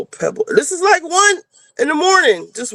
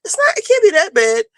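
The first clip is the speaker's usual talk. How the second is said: mock nagging daughter, higher; 8 semitones higher than usual. Mother imitating her daughter's nagging, mildly mocking. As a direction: imitate the pushy daughter lightly. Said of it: "she bothers me".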